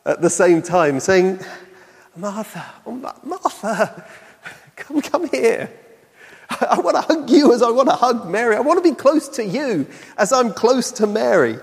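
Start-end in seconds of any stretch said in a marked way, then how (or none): 2.1-11.6 much higher and gentle, with a small laugh; tender encouragement. Retold or imitated. Jesus calling Martha close, higher, tender and encouraging. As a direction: coax her in close, affectionate and inviting. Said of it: "mixing disappointment with encouragement".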